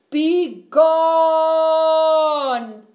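huge, long-held, high roar. A narrator's reading. Bear banishes the mouse, roaring. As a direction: bellow and stretch the last word. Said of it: "roared Bear".

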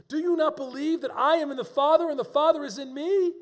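high, loud, insistent challenge; impassioned preaching. Acted. Preacher voicing Jesus challenging Philip to believe, loud and insistent.

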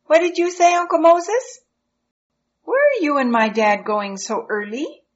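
put-on high bright child voice; curious young boy asking; eager little boy. Eliezer child voice in story.

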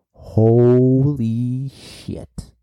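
long drawn-out amazed exclamation; stunned. Real, not acted. Manga reviewer reacting to the biggest bomb, stunned. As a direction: stretch every word out in astonishment.

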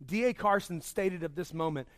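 usual speaking voice

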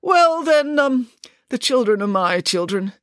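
hesitant, halting admission; father caught out by a journalist, reluctant. Acted. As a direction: give it up unwillingly. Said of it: "he reluctantly admitted".

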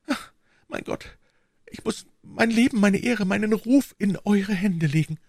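hushed gasp, then high and fervent; despairing queen. Acted. Queen lays her life in servant's hands, despairing. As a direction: sigh to God, then surrender in a high voice.

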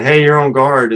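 a quick, loud retold radio warning; mock radio call. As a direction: call it out like someone warning you on guard frequency. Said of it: "we also had someone saying".

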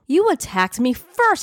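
high-pitched, outraged accusation; shouting back. A narrator's reading. Man to woman who hurt him, shouting back. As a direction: throw the blame back, pitch way up. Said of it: "he shouted back".

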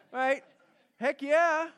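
high and loud, heck yeah; excited shout. Real, not acted. Preacher hyping up his congregation, excited shout. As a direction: whoop it up to the crowd.

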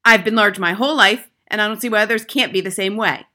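loud, indignant declaration; affronted, overbearing headmistress; snapping. A narrator's reading. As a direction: hold forth, rushing the end. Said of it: "she snapped".

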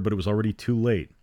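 ordinary delivery, nothing marked

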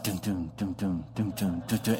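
low, soft thumping "dum dum"; steady heartbeat noises, low and soft; imitated heartbeat. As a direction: mimic a pounding heart under your breath. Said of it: "this is when the heart's like".

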